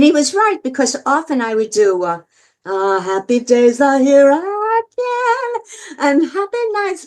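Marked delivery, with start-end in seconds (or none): 3.0-7.1 big, slow belting [name] impression. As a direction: belt it like Streisand, stretching the notes. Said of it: "big, big [name] thing".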